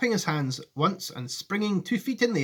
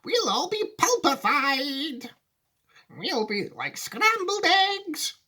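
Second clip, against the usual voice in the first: high, slow granny wail; Grandma Georgina; 12 semitones higher, slower than usual. Acted. Grandma foreseeing a crash, high drawn-out wail. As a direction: wail it, stretching every word. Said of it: "said Grandma Georgina".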